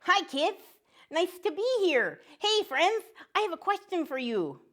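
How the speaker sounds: high, cheerful cartoon duck voice; a chirpy little duck saying hello to kids; friendly duck puppet.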